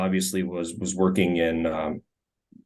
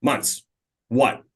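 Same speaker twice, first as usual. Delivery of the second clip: a light hint of the gruff coach on the phone; lightly voiced coach taking a quick call; Steve Nelson voice; 3 semitones higher than usual. Telling the story of the coach answering the phone, a light coach voice. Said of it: "in my best Steve Nelson voice".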